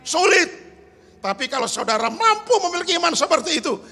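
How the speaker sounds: loud, high, fast preacher's cry; rally the congregation at full voice; full-force preaching. Acted. Pastor urging the congregation, loud and fast.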